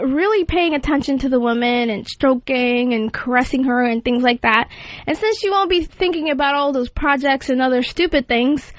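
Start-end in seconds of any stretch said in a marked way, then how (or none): none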